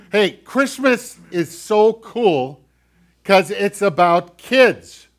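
big, loud and slow, full of delight; loud, slow proclamation of joy; excited. Real, not acted. Preacher rallies kids with enthusiasm.

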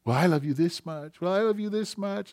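high, sing-out voice, rising each time; light, high playful parent-and-child voice; little kid game. Retold or imitated. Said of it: "you do a little kid".

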